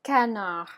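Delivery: French "canard", the D left unspoken; silent final D. Shown as a demonstration. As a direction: end "canard" on "ah", no D. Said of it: "gonna be pronounced canard".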